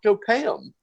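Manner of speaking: high, rushed mock panic; alarmed urging. Friend urging Marley to pay the cab driver, high mock panic.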